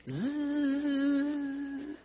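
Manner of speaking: high, drawn-out electric whirr; steady high motor drone; motorized chair buzz. Retold or imitated. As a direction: imitate the little chair approaching. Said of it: "the sound of that little thing".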